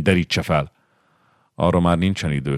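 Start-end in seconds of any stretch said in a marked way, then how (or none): none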